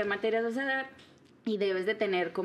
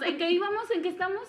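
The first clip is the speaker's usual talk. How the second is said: clueless teacher voice; 7 semitones higher, faster than usual. Retold or imitated. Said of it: "que le preguntes a los alumnos".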